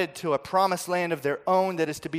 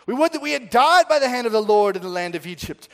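grumbling outcry; 7 semitones higher, 8 dB louder than usual. Acted. Grumbling people quoted.

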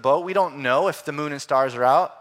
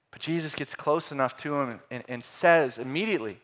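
usual speaking voice

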